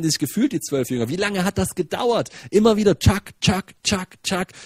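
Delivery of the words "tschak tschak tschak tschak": high, punchy handing-out sound effect; repeated chopping "tschak", easing off in volume; rhythmic "tschak" noises. Retold or imitated. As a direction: imitate food being dealt out, piece by piece. Imitating repeated handing out of food.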